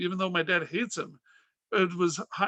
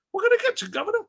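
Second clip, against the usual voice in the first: very high, cute British accent; 3 dB louder, much faster than usual. Shown as a demonstration. Concession clerk to the governor, cute British accent. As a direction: squeak it in a hammed-up British accent. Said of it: "said it with a British accent".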